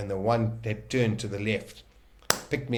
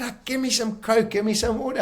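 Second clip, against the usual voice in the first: mock pleading; 12 semitones higher, 3 dB louder than usual. Begging for drink after rescue.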